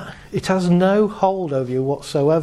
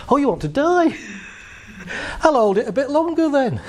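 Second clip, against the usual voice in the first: teasing laugh; 8 semitones higher than usual. Retold or imitated. Voicing the mind's mock reply.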